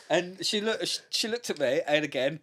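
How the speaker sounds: normal talking voice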